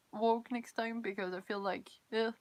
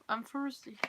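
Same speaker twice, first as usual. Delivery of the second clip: ordinary talk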